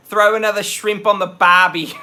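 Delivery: loud, hammed-up Australian accent; jokey, overdone Australian twang; terrible Australian impression. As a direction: bellow a bad mock-Aussie catchphrase. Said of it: "I'm doing all these terrible impressions now".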